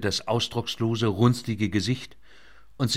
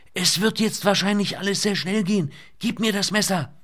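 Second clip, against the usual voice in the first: put-on young woman shouting; 10 semitones higher than usual. A narrator's reading. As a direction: raise voice over the din. Lynette keeps shouting over rotors.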